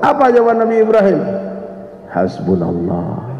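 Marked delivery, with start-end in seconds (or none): 2.1-3.3 low, soft and slow, utterly assured; calm, hushed declaration of trust; serene reply. Retold or imitated. Ibrahim answers Jibril in the fire, serene.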